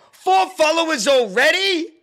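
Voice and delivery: high, loud excited shout; hyped-up amazement. Pretend. Streamer cheering a tiny follower count, shouting excitedly. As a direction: yell it in giddy disbelief.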